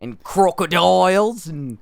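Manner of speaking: hammed-up Aussie voice, slowed a touch; joking Australian accent about kangaroos; mock Australian accent. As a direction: play up a cartoon Australian. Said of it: "go straight to Australian every time".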